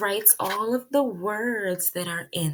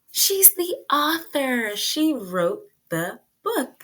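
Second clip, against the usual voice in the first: slow, high and louder, each word landed; emphatic answer; 5 semitones higher, 4 dB louder, slower than usual. Shown as a demonstration. Teacher answering her own quiz question, slow and emphatic. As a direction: spell the answer out for little kids.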